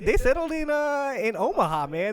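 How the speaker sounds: high, loud, excited acted reply; hammed-up enthusiasm, pitched high; eager answer. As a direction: play someone delighted to be guessed right. Acts out settler descendant's excited reply.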